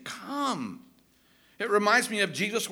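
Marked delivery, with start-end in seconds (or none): 0.0-0.8 soft, higher, drawn-out invitation; gentle beckoning. Acted. Preacher voicing God inviting the people, gentle beckoning. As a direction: call tenderly, as if with open arms.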